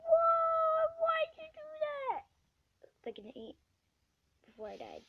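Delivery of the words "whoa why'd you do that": very high, loud wail; high crying protest after dying; high anguished cry. Real, not acted. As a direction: wail at the killer.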